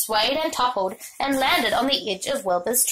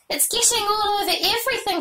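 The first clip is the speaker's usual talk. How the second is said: put-on girl shouting, voice jumping higher; 10 semitones higher than usual. A narrator's reading. Fern shouts at Avery about the pie. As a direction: Fern yells in alarm at the mess. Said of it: "shouted Fern".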